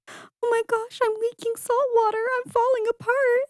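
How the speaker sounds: very high, hammed-up tearful panic; mock crying. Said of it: "Aqua starts crying 'cause she's feeling all the emotions".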